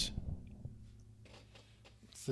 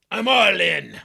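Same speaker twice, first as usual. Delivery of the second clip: a touch louder bold bet; confident all in; 4 semitones higher, 9 dB louder, slower than usual. Acted. Opponent announces his bet, lightly bold. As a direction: push it all in. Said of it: "He looks at his hand".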